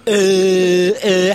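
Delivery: long droning mock "uh"; mimicked filler "uh". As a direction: hold each "uh" like a stalled talker. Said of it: "while you've put your thoughts together".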